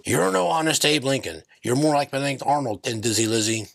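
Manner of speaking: usual speaking voice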